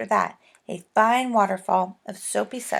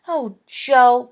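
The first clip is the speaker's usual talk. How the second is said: pleading cry; 4 semitones higher, 9 dB louder than usual. A narrator's reading. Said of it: "cried Bessie".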